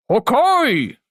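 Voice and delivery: big airy voice-acted exclamation; loud villain cry. Shown as a demonstration. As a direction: call it out like a god's battle cry. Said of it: "when you say something loud and you try to voice act".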